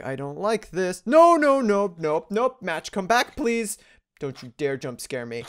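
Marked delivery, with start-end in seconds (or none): 1.1-3.8 loud panicked pleading; frightened begging, voice jumping up; scared horror game streamer. Real, not acted.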